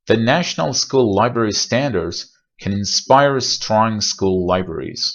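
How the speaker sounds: slow, careful, l held in school libraries; slow model sentence. Shown as a demonstration. Teacher reading an example sentence slowly. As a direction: read the sentence slowly and clearly. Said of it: "چند تا مثال بزنیم".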